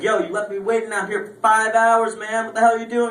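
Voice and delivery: pissed-off shout at the friend; angry yell. Retold or imitated. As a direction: chew him out for making you wait. Yelling at late friend.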